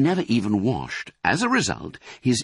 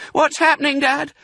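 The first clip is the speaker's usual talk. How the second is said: very high little fox voice; high-pitched, loud, panicky child's cry; alarmed cry; 6 dB louder than usual. A narrator's reading. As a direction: cry out like a frightened small cub. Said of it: "cried the small foxes".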